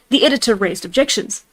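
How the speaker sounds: plain reading voice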